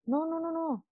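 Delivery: quick, hushed string of no's; soft scolding no. Real, not acted. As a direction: gently shoot the idea down. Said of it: "rechaza la frase". (un no, no, no suave y rápido, como regañando con cariño)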